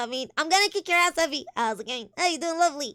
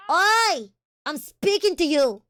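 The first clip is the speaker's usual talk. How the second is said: loud, higher shout at him; angry shout; 3 semitones higher, 7 dB louder, slower than usual. Acted. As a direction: bark it to get his attention. Girl yells at young man.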